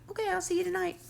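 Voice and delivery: imitating Joey. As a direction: toss it off high, mocking him.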